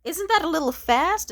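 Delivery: high, loud mock-prudish whine; mock his prim surprise; mocking prissy voice. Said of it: "they'll be like".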